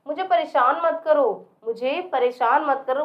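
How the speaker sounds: ordinary talk, nothing marked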